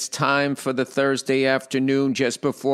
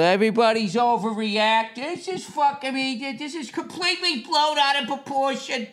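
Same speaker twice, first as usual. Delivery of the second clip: high, softer whiny smug voice; mocking know-it-all; 10 semitones higher, 3 dB quieter than usual. Mocking the people who call the pandemic overblown, in a whiny high voice. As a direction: sneer at the skeptics in a whiny falsetto. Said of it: "I love all these fucking assholes".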